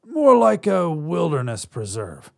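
a hint of a casual alien. Alien shrugging it off.

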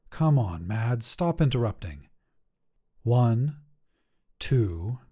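ordinary delivery, nothing marked

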